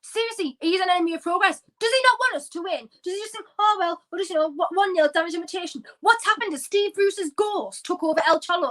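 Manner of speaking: high-pitched incredulous ranting; exasperated rant. Real, not acted. Fan ranting about the negative manager, exasperated. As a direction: demand answers in outrage.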